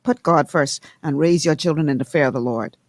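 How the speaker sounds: ordinary talk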